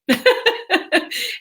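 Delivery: high breathy giggle; embarrassed little laugh. Real, not acted. Laughs after swearing.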